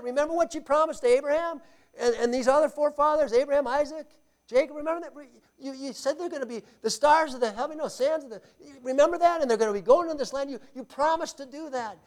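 a lightly voiced Moses pressing his case; Moses pleading. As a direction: remind God urgently of his promise. Moses pleading God's promises.

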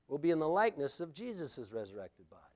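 hushed unvoiced repeat of the line; whisper. Shown as a demonstration. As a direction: whisper it so they lean in close. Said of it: "Is everyone hearing that?".

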